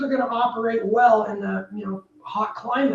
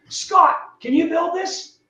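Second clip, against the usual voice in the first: lightly acted, raised-pitch question to a contractor; builder calling over; 5 semitones higher, slower than usual. Builder turns to ask contractor.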